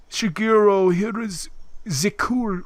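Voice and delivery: put-on botched French-Russian accent; bad French accent. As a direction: imitate a French presenter, word by word. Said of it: "why a French guy sounds Russian".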